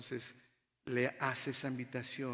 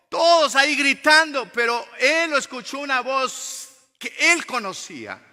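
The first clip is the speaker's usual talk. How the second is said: loud, higher-pitched sermon delivery; forceful preaching; 11 semitones higher, 17 dB louder than usual. Real, not acted. Preacher telling the church Jesus walks on the sea, forceful. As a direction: preach it out, hammering key words.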